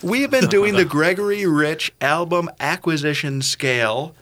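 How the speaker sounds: defensive, pitch jumping well up; high, defensive. Real, not acted. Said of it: "his voice did get pretty high".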